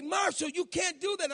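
high, loud warning cry at her husband; wife's alarmed shout. Retold or imitated. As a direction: yell it like a worried wife in the passenger seat. Wife scolding him in car.

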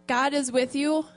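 ordinary talk, nothing marked

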